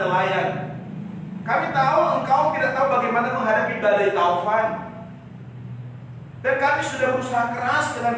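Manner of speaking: high, loud reproachful plea; loud complaint. Retold or imitated. Disciples reproach sleeping Jesus, loud and high. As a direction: scold the sleeping teacher, loudly.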